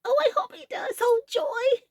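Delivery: very high delighted squeal; joyful cry. A narrator's reading. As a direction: burst with joy at the peanuts. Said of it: "cried the big fellow".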